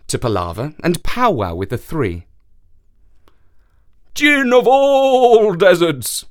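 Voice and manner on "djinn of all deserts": slow, high, loud horse call. Acted. Horse voice in the story.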